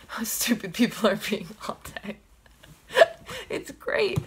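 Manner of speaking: giggling through the words; laughing. Real, not acted. Fan laughing to viewers about Jeff Goldblum, giggly. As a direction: laugh while praising him.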